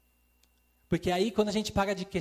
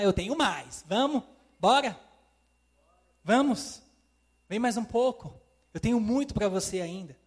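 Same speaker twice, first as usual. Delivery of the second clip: higher, louder eager call to come on; voicing the Lord urging; 4 semitones higher, 5 dB louder than usual. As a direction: urge them on, higher and louder, like a coach. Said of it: "Ele vai falar".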